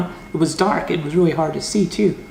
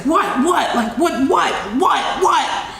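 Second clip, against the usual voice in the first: startled what what; 9 semitones higher, slower than usual. Retold or imitated. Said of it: "I'm like this".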